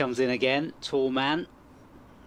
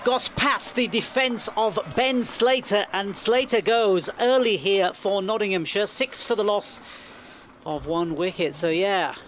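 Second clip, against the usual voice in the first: loud, high, still buzzing; excited commentary; 6 semitones higher, 5 dB louder than usual. Real, not acted. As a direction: keep the wicket excitement going. Commentary after the wicket.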